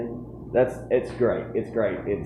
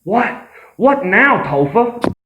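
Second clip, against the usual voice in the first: irritated snap; 10 dB louder, slower than usual. Acted. Annoyed character snapping at host.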